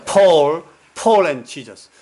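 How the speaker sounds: emphatic PAUL, then the whole phrase for the group to echo; model for repeating. Shown as a demonstration. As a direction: lead a congregation in repeating "Paul and Jesus". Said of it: "한번 제가 따라해 보시겠습니다".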